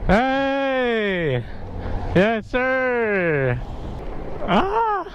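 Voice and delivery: high excited shouts. Real, not acted. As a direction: whoop it out high. Cheering passing car.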